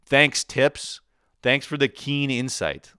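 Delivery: high, loud mock gratitude; sarcastic thanks. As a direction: thank them, dripping with sarcasm. Sarcastic thanks to advice-givers.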